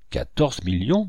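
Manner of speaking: ordinary delivery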